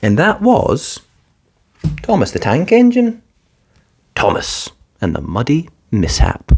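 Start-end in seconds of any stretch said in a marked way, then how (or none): none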